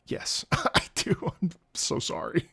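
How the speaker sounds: soft, breaking into laughter; laughing apology. Retold or imitated. Pupil apologizes to teacher after class, retold softly through laughter.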